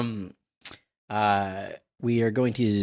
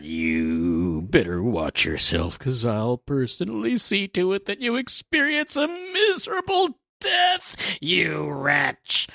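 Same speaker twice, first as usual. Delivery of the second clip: hammed-up cartoon villain rant; villainous threat; 6 semitones higher, slower than usual. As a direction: build from menacing drawl to shrill mock fury. Villain threatens Gerd, acted out.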